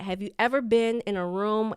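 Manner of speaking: ordinary talk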